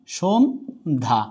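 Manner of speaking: সন্ধ্যা drawn out slowly, no doubling; slow model pronunciation. Shown as a demonstration. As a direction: stretch সন্ধ্যা slowly and softly as "শোন্ধা". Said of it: "এ হচ্ছে সন্ধ্যা".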